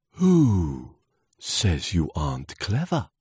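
gusty, swooping character voice; swooshing wind. Acted. Wind reassuring the doubting leaf, swooshing. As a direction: swoosh the first word out. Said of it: "swooshed the wind".

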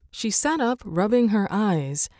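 ordinary delivery

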